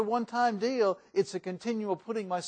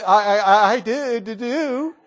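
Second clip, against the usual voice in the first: nervous stammer; 3 semitones higher, 10 dB louder than usual. Shown as a demonstration. Preacher mimics a scared groom at the altar, stammering. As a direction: stammer out the vow in mock panic. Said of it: "And don't say".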